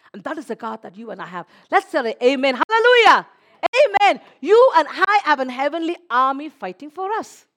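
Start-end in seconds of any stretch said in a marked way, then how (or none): 1.7-7.5 loud, high, rousing preacher; full-force preaching. Acted. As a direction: build it up like a revival preacher. Rouses the congregation.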